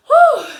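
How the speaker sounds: high, loud exhale of "whew"; real out-of-breath whew; winded whew. Real, not acted. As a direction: blow out your breath after getting worked up. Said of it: "I'm running out of breath".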